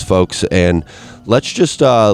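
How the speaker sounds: ordinary talk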